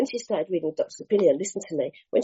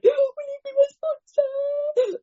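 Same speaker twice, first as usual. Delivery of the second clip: very high, slow, crying plea; tearful plea; slower than usual. Retold or imitated. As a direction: beg through tears, drawn out. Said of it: "Like that".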